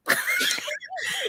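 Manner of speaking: high, shrieking laugh of relief; relieved laugh. Real, not acted. Relief after child found.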